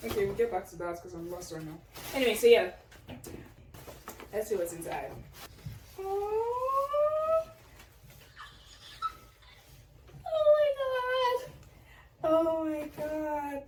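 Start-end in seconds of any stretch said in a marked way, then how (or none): 6.0-13.6 very high, drawn-out oh my God; high surprised gasp. Real, not acted. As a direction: gasp at the gift, high and slow. Opening surprise gift, gasping.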